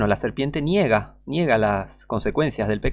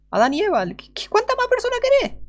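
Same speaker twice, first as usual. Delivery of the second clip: much higher, strained cry of disbelief; incredulous outburst. Real, not acted. Preacher incredulous at skeptics, very high strained cry. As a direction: squeak it out in exasperation.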